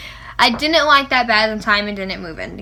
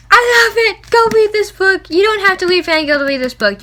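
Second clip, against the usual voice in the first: much higher, louder excited squeal; fangirl squeal; 8 semitones higher, 5 dB louder than usual. Real, not acted. Fan urging viewers to read the book, squealing. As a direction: squeal it out to everyone. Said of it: "fangirl attack".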